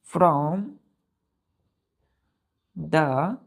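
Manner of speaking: each word said slowly, one by one; slow drawn-out phrase. Shown as a demonstration. Teacher reads the sentence to children, very slow. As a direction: spell it out slowly for the class. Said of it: "क्या बोला गया बच्चों".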